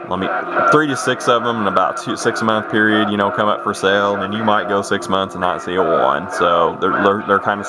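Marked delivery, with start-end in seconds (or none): none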